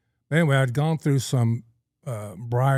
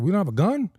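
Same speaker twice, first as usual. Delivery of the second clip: high-pitched acted disbelief; mock-baffled objection, pitched up; retold incredulous protest; 8 semitones higher, 4 dB louder, faster than usual. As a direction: imitate yourself protesting, voice raised. Acted protest to ranch friend.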